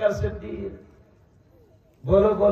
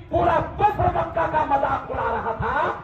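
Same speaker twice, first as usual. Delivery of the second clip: ordinary delivery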